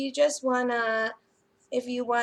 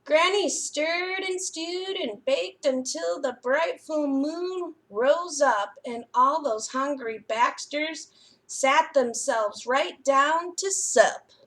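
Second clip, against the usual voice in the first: put-on lively storybook reading to children; picture book reading; 3 dB louder than usual. A narrator's reading. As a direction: read it like a bedtime story for little ones.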